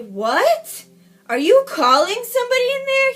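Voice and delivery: sharp rise into a high, loud question; imitated angry call. He calls from the kitchen on hearing the beep, imitated angry call. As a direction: mimic him calling out, high and loud.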